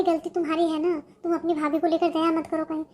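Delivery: usual speaking voice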